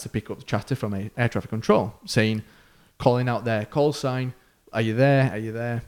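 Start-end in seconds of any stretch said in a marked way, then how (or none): 4.7-5.3 a slightly raised radio call. Retold or imitated. Control calling the call sign. 5.3-5.8 much softer, fading repeat; fading call. Retold or imitated.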